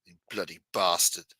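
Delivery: low put-on British curse; understated mock-British insult; British accent. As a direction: curse like a disdainful Brit. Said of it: "it just sounds better when you say it British".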